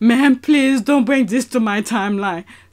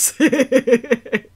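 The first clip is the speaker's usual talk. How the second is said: normal talking voice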